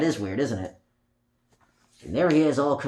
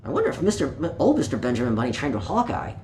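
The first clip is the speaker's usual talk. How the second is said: usual speaking voice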